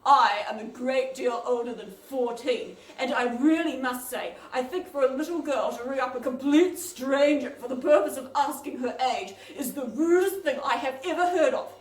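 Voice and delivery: put-on loud, stern scolding old woman; terrible voice. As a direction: scold the rude child haughtily. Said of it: "this terrible voice said".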